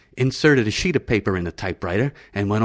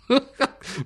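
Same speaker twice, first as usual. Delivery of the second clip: real laugh. Real, not acted. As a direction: burst of high laughter. Narrator laughing at description.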